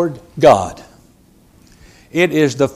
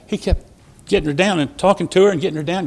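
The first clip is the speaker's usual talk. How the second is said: usual speaking voice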